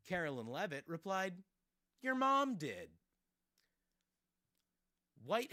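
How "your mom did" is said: high, loud, drawn-out taunt; exaggerated quote of a snotty reply; mock jeering retort. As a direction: fling it out as a childish comeback. Quoting press secretary's reply.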